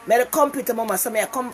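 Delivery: ordinary talk, nothing marked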